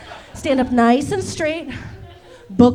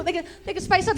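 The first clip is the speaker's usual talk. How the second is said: ordinary talk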